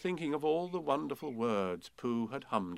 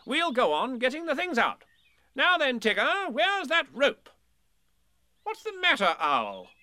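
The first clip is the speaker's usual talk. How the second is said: higher, brisk and bossy little rabbit; busy rabbit voice; 8 dB louder than usual. A narrator's reading. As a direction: play the eager organiser.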